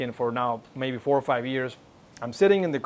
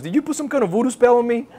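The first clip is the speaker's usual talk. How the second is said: high, loud and fast, half-joking shock; astonished question; 9 semitones higher, 5 dB louder, faster than usual. Retold or imitated. A crying friend asking the preacher, high loud astonishment. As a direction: blurt it out, amazed and unsettled.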